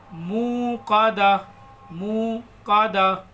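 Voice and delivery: drawn-out chanted reading; measured tajweed chant; slow recitation. Shown as a demonstration. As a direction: recite slowly.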